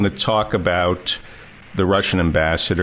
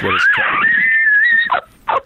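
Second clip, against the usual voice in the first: shout; 4 dB louder, much slower than usual. Acted. Opening yell of the show.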